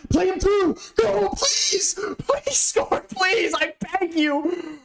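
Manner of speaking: high, breathless begging for a goal; I beg you stretched out slowly; desperate pleading. Real, not acted. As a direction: plead with the player, voice cracking up high.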